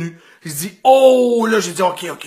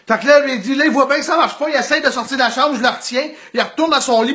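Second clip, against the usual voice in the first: ordinary talk, nothing marked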